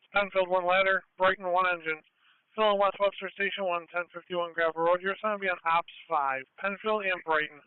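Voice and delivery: urgent firefighter radio. Real, not acted. Dispatcher tones out mutual aid.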